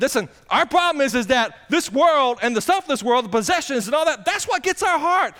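high, loud preacher's climb; full-force preaching. Acted. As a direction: press the point on the heart.

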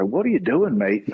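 hammed-up British accent, pitched well up on the question; mock British bloke, jumping up in pitch; bad British accent. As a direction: play a hungover British mate, badly imitated. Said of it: "this may be a bad British accent".

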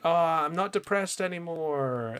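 lightly hammed-up carefree girl; mock cheerful. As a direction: parody her sudden breeziness. Mocking a character's sudden cheer.

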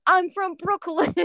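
put-on high, slow Brooklyn accent; thick New York accent, drawn out. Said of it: "centipede goes".

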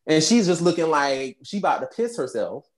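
usual speaking voice

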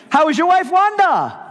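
very high, loud, eager phone greeting; excitable Brother Vic. Retold or imitated. Vic calling the pastor years later, overjoyed. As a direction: greet him like a long-lost friend. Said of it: "excitable kind of guy".

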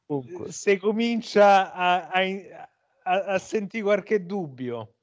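a hint of Roman accent. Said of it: "l'accento romanista".

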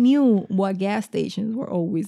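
talking loud and excited; too loud for the mic. Real, not acted. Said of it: "You're mad loud right now".

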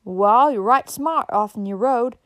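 loud, slow country drawl; stretched rustic holler; backwoods woman. A narrator's reading. Backwoods woman answers stranger.

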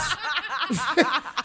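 loud laugh breaking out, high and bright; big burst of laughter. Real, not acted. Laughing at his own story to the host. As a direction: crack up at the memory of eating the grasshopper.

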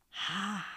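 breathy whispered "ha" from the throat; soft throaty "ha" whisper. Shown as a demonstration. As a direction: whisper "ha" softly from the back of the throat. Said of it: "Whisper the word".

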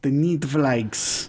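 slow mock Russian "The Neat Flags"; imitated Russian accent. Echoing a friend's fake Russian accent for laughs. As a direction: copy the friend's bad Russian, slowly. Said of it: "I don't know what accent that was".

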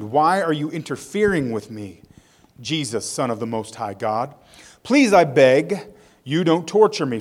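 ordinary talk, nothing marked

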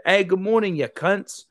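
high cheerful good morning; lightly voiced Aussie cheer; cheery Aussie greeting. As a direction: greet the room happily. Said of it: "he'd be like".

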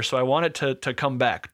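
normal talking voice

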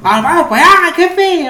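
high, loud put-on northern Mexican voice; norteño accent. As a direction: ham up a norteño drawl talking to the boss. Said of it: "como que más".

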